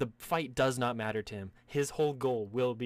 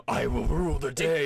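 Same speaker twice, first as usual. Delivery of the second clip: loud, raised villain proclamation; boss villain; 4 semitones higher, 9 dB louder than usual. Acted. Boss proclaiming to the heroes, loud and grandiose. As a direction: declaim it like a big boss. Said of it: "the fucking boss is like".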